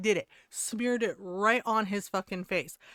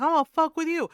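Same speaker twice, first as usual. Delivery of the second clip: loud, higher retold threat; imitated yelling; 6 semitones higher, 7 dB louder, faster than usual. Woman threatening the prankster, imitated yell. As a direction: act out her yelled threat. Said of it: "She yelled, she's like".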